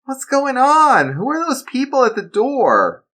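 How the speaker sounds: put-on a scared boy asking what's wrong; worried child. Boy asking his dad about the men, worried. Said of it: "Nicholas asked, worried".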